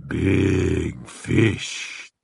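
low guttural greedy cry. A narrator's reading. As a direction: growl it with hunger. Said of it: "cried in a guttural, greedy voice".

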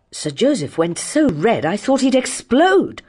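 usual speaking voice

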